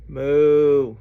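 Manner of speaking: loud, long moo; cow moo. Acted. Reader reviewing animal sounds with children, loud cow moo. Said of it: "He can sound like a cow".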